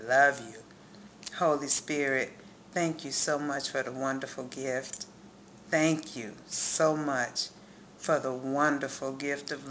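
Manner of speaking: heartfelt prayer. Real, not acted. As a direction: pray from the whole heart, lingering on each thanks.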